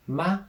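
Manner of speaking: ma high and level, first tone. Shown as a demonstration. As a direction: hold "ma" high and flat. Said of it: "first tone".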